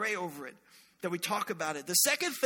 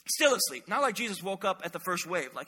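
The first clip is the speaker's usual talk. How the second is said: ordinary talk, nothing marked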